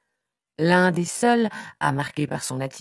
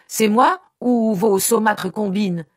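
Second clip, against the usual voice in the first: slow, loud, high accusation; vociferating; 6 semitones higher, 8 dB louder than usual. A narrator's reading. Son blames his mother's schemes, vociferating. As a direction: throw the blame back, drawn out and indignant. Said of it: "vociféra-t-il".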